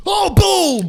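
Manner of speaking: high, loud mock hunter cry; hunting shout. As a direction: shout as if shooting a duck.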